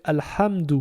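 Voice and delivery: teacher sounding out Alhamdou; Quranic reading. Shown as a demonstration. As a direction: give Alhamdou a careful a-l-hamdou. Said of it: "il va se lire comme si c'est un alif avec un A".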